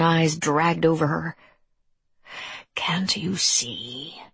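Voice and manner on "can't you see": very slow, soft creature whisper. A narrator's reading. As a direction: breathe it out slowly, almost voiceless. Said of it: "he whispered".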